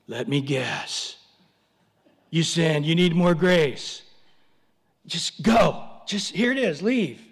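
a fed-up Jesus shooing you off; exasperated Jesus. Retold or imitated. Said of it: "Jesus now is just shaking his head".

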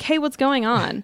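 mild imitation of calling through to a neighbor; retold yell. Inmate calling to Lyda, retold mild yell. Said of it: "So she yells to Lyda".

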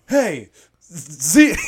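loud, high mock American accent; super American accent. Police guy busting in, super American accent. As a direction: exaggerate a brash American accent. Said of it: "he says it like a super American accent".

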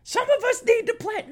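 high, mocking whine of a busy planner; high mocking falsetto; mock whiny voice. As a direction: sneer it out like a fussy scheduler. Mocking a scheduler's complaint.

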